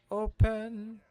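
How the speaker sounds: high, loud burst on the word; calling out. Pretend. Gamer opening loot, calling out. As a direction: belt it out, pitched well up.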